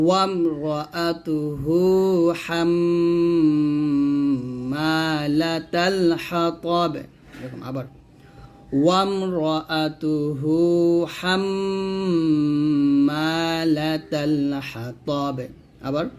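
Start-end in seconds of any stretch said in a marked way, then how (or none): 0.1-6.9 very slow, long hummed m and held vowels; Quran recitation. Shown as a demonstration. Teacher models stopping on the word, slow recitation. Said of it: "তাহলে একসাথে হবে". 8.8-15.4 very slow, long hummed m and held vowels; Quran recitation. Shown as a demonstration. Teacher models stopping on the word, slow recitation.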